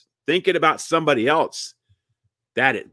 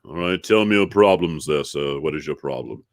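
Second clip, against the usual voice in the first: low-pitched therapist impression; doctor; 9 semitones lower than usual. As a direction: play the doctor, low and unhurried. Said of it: "And he said".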